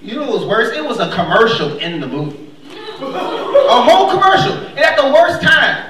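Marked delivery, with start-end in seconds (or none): 3.0-5.8 outraged emphasis. Real, not acted.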